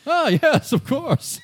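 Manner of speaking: put-on high, chuckling old gent; old scientist voice. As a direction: laugh it off like a flustered old man. Old doctor corrected on title.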